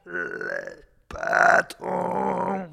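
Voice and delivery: slow, high, rasping caricature of a union leader; mock hoarse firebrand voice; hoarse union boss impression. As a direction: stretch it out in a raspy put-on voice. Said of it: "Cette imitation me fait vraiment mal".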